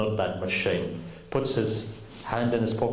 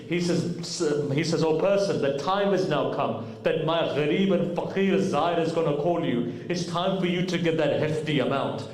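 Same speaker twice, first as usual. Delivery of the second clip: climbing higher, preaching at full force; rising impassioned preaching; 5 semitones higher than usual. Acted. Preacher to congregation, fervent and rising. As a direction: lift the story into a fervent climax. Storyteller builds to peak.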